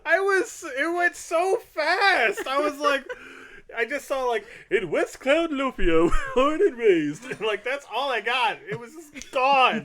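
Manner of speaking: high, loud, laughing rush of excuses; flustered and laughing. Real, not acted. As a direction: babble it out while cracking up.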